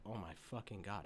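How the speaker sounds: low, soft stunned murmur; hushed awe. Retold or imitated. Reviewer recalling first watch, low and stunned. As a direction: let it fall out of you, stunned. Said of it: "and said".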